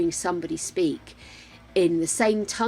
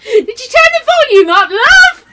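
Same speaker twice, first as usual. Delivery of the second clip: high, loud shout through laughter; laughing shout; 15 dB louder than usual. Real, not acted. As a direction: yell it at him half laughing. Shouts the question to partner.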